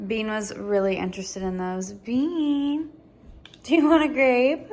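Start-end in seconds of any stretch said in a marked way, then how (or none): 1.9-4.7 higher coaxing voice to the dog; pet talk. Real, not acted. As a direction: coax the dog sweetly, pitched up. Offering a grape to the dog.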